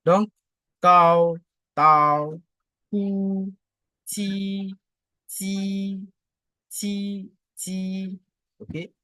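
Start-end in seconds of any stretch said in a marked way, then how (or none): 0.8-2.4 "tao" loud and level; forceful, unbending "tao"; first tone. Shown as a demonstration. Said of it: "le ton constant, le premier ton". 2.9-3.5 "pu" high, long and level; sustained, unbending high "pu"; first tone. Shown as a demonstration. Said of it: "le ton constant, le premier ton". 4.0-8.2 "ti" high, long and level; sustained, unbending high "ti"; first tone. Shown as a demonstration. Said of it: "le ton constant, le premier ton".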